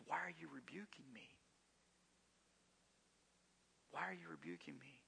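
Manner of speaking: put-on hushed, childlike whisper; a small voice asking why it is being rebuked; scolded child. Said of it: "like a child being scolded".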